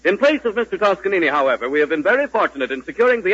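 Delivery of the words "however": usual speaking voice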